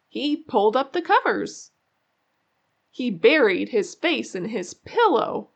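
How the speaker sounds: ordinary delivery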